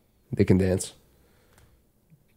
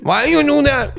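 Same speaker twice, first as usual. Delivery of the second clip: high laughing mock accent voice; mock Mexican man; slower than usual. Imitating a scolding Latin partner, high laughing mock voice. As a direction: fire it off in a jokey put-on accent. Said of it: "that sounds like a Mexican man".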